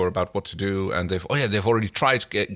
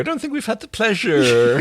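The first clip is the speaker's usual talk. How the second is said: high, slow, giggling smarm; hammed-up oily greeting; laughing villain mimic; 11 semitones higher, 4 dB louder than usual. Co-host mimics Scorpius laughing, high and smarmy.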